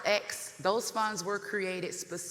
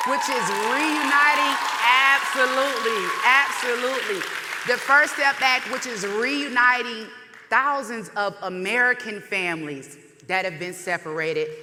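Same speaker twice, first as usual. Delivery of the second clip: preaching at full force; 2 semitones higher, 11 dB louder than usual. Real, not acted. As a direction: preach it to the back row. Rally speech at full force.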